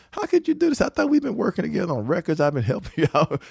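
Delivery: high-pitched, hurt pleading; mock heartbroken. Cheated songwriter protesting to his collaborator, mock heartbroken. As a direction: retell it as a high, wounded protest. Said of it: "I was brokenhearted 'cause I'm like".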